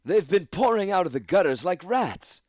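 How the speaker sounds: loud, high, scornful; imperious declaration. Acted. Eldest addresses the council with scorn. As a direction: proclaim it with disdain. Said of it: "declared Eldest Jameth".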